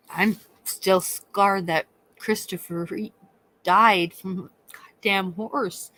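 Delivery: ordinary talk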